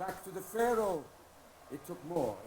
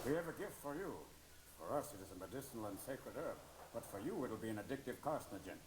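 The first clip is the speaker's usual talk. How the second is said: low, soft, grave voice; solemn Native elder; 10 semitones lower, 8 dB quieter than usual. Acted. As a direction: deliver the gift with dry solemnity, much lower. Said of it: "And the Indians go".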